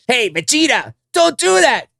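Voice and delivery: high, pleading little sidekick; whiny high sidekick begging. Retold or imitated.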